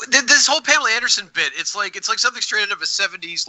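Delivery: ordinary talk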